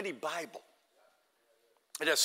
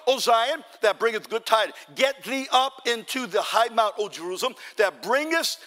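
forceful scripture preaching; 5 semitones higher, 6 dB louder than usual. Acted. Preacher reads Zion's good tidings to congregation, forceful proclamation. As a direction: proclaim the verse, building higher and louder.

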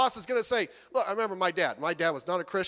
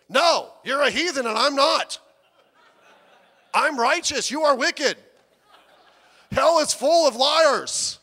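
mock preachy condemnation, louder and slow at the end; hammed-up holier-than-thou lecturing; self-righteous; 6 semitones higher, 8 dB louder, slower than usual. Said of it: "jump up on your high horse".

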